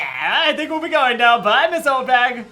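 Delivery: cheeky loud farewell to the guard; loud hasty exit. Acted. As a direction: chirp it out while backing away fast. Sneaking away past the guard.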